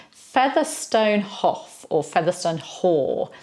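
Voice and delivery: "Featherstone Hough" read as written, slowly; spelling readings. Shown as a demonstration. Teacher reads the name as it looks. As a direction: sound out "Featherstone Haugh" as spelt. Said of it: "Nope, that's Fanshawe".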